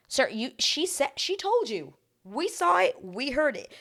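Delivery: high-pitched, incredulous telling-off; exasperated protest. Retold or imitated. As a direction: plead the obvious at him. Exasperated mock address to him.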